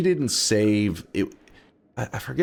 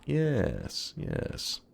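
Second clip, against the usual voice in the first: soft smug agreement; 7 semitones higher, 9 dB quieter than usual. Pretend. Agreeing to mock praise.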